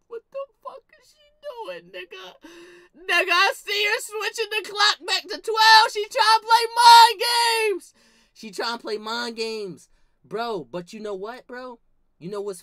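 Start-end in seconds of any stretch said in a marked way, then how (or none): 0.1-2.3 squeaky high, shocked whine; high squeal of disbelief. Retold or imitated. Catching grandma changing the clock, a high disbelieving squeal. 2.9-7.9 high and loud, slowing on mind games; indignant high rant. Retold or imitated. Telling the audience about grandma's mind games, a high loud rant.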